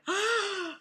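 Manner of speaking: loud, high horrified gasp; shocked gasp. A narrator's reading. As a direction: suck in air in collective shock. Beauty parlor reacts to the wolf.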